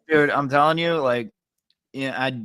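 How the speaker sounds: normal talking voice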